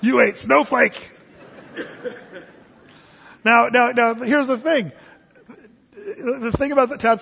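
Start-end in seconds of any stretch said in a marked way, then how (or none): 0.0-1.1 teasing gloat. Retold or imitated. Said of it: "They're like".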